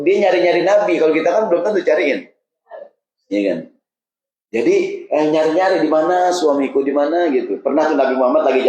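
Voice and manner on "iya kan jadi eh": ordinary talk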